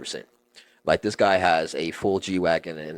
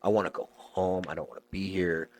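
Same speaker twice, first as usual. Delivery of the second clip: almost crying; 6 dB quieter than usual. Retold or imitated. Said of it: "almost crying".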